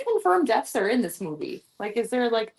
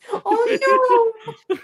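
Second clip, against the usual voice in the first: a sing-song fake oh no; mock dismay; 10 semitones higher than usual. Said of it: "That's what he always said".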